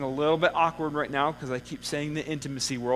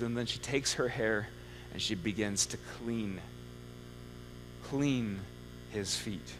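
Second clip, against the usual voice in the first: hushed tender telling; 3 semitones lower, 6 dB quieter than usual. A narrator's reading. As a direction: let it drop to a reverent hush. Tender retelling of woman's surrender.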